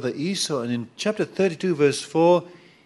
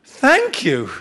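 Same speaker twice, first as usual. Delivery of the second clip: higher, louder, mock-thrilled thanks; mock-grateful God; 8 semitones higher, 9 dB louder than usual. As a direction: gush like you can't believe your luck. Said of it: "you can see God saying".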